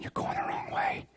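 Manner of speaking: near-whispered, under the breath; inner thought. Acted. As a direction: murmur it to yourself, barely voiced. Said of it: "In my head, I'm thinking".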